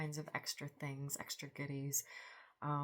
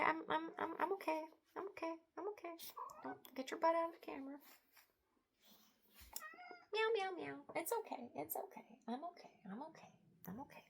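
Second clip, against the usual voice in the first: much higher, soft cooing to the cat; pet talk; 12 semitones higher, 5 dB quieter, much faster than usual. Real, not acted. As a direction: soothe the kitty, high and gentle. Soothing the cat on camera.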